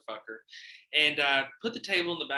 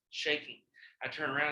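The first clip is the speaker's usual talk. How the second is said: normal talking voice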